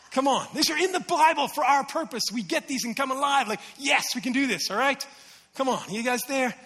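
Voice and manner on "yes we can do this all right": usual speaking voice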